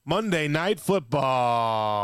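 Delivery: slow, drawn-out promo voice; mock announcer. Friend hyping the Monday game, mock announcer. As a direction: stretch it like a TV spot.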